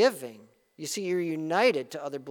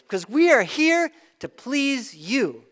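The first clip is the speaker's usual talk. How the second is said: higher, slower, a hint of an eager-to-please clerk; eager business voice; 7 semitones higher, 8 dB louder, slower than usual. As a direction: lightly play the company eager to please the customer. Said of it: "Why do they say".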